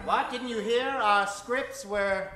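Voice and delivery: ordinary talk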